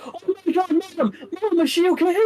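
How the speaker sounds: very high, loud, panicked cry. Acted. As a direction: cry out for her mother in alarm. Stacy sees mom held.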